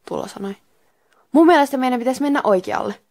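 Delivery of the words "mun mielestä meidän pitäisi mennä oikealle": louder, higher, absolutely sure; emphatic, confident character line; dead certain. A narrator's reading. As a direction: state it with total conviction. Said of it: "sanoi aivan vuoren varmana".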